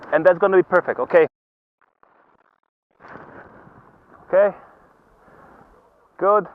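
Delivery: high, loud encouraging shouts; cheery ski instructor shouting. Real, not acted. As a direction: cheer the skier on from uphill. Instructor calling to skier on slope.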